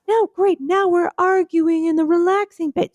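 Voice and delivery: the first shoe fights for its turn; first shoe, bickering. Pretend. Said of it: "I'm a shoe".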